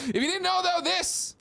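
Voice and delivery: hyped show intro; show host opener. Acted. Show intro announcer opening.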